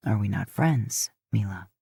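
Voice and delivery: low, hurt man's voice; Dean pleading low. Acted. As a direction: ask it low and wounded. Dean's voice, much lower.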